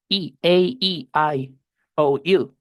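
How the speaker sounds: "I, A, E, I, O, U" named slowly, letter by letter; Spanish vowel names. Shown as a demonstration. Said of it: "así se llama la letra en el alfabeto". (vocales en español, despacio)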